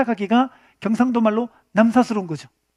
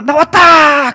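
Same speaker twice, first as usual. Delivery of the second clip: put-on loud burst of a kid arriving; mock shout; 5 semitones higher, 10 dB louder, much slower than usual. Kid bursting through the door, shouting. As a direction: call it out like a child bursting in.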